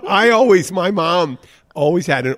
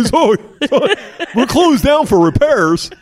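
very high, loud goofy mascot voice; Family Vacation moose; 11 semitones higher, 7 dB louder than usual. Retold or imitated. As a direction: announce it as a big cartoon moose. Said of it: "the moose from Family Vacation".

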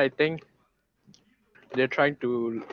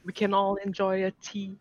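higher put-on British voice; mock British accent; 7 semitones higher, 3 dB quieter than usual. Friend trying British talk about tea, mock British accent. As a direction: try a British accent, pitched up. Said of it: "that's not how they talk".

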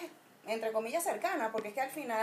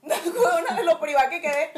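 laughing; 4 semitones higher, 8 dB louder than usual. Real, not acted. Laughing while telling childhood story.

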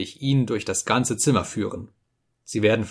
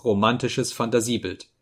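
usual speaking voice

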